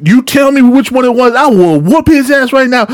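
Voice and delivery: high, fired-up vow to the caller; hyping her up. Retold or imitated. Worker promising an angry customer payback, hyped up. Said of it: "I'd be hyping them up too".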